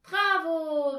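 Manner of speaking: loud, high cheer. A narrator's reading. Girls applauding their pupil's success, cheering loudly. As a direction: shout it in praise, pitched high. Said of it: "riefen Tina und Anja".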